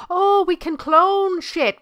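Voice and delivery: high, loud and drawn out; excited discovery. Real, not acted. Delighted discovery about cloning.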